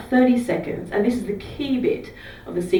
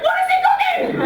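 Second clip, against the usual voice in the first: high, loud mock-curious passenger voice; nosy passengers; 3 dB louder than usual. Bus riders eavesdropping on a call, a high loud nosy squeal. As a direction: squeal it like a gossiping commuter. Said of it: "everyone on the bus is like".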